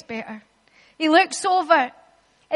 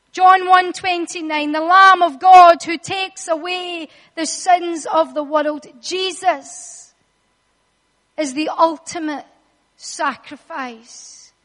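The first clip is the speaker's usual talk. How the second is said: ordinary talk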